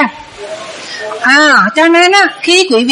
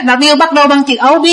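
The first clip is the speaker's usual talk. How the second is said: ordinary talk, nothing marked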